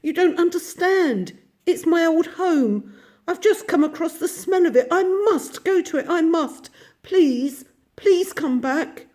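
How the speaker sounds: high, loud, desperate begging voice; frantic plea to turn back home; anguished pleading. A narrator's reading. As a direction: plead as if your heart is breaking. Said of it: "pleaded the Mole in anguish".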